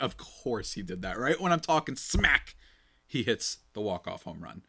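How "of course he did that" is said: deadpan, drawn-out "of course"; slow, resigned disbelief, dropping softer; unimpressed non-reaction. Retold or imitated. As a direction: say it like you saw it coming. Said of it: "I don't even react. I just go".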